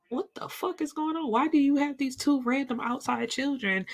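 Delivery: high-pitched, exasperated questioning; mock outraged disbelief; incredulous demand. Acted confrontation of the cheating father.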